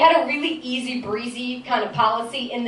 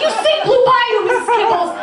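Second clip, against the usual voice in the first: put-on kid to cat; 9 semitones higher, 3 dB louder than usual. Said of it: "I was like".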